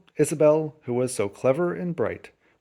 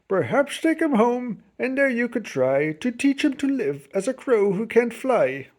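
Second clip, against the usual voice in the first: vet's voice; 6 semitones higher than usual. A narrator's reading. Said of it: "the doc said".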